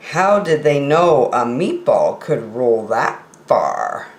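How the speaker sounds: ordinary delivery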